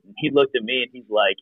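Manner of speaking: ordinary talk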